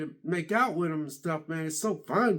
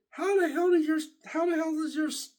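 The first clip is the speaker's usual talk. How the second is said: much higher, disbelieving outburst; incredulous; 11 semitones higher than usual. Real, not acted. Asking coworker, incredulous and high-pitched. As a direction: sound baffled and shocked.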